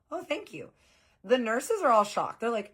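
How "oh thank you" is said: flattered surprise. Retold or imitated. Flattered by the old man.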